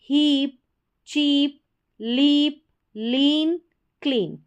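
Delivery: word-by-word list with a long held ee; long EE sound. Shown as a demonstration. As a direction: stretch the ee in each word, "बीच, रीच, बीक". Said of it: "लंबी ई के रूप में".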